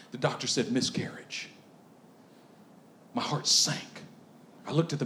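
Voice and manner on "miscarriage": hushed, breathed word of bad news; whispered grim diagnosis. Doctor's grim word retold.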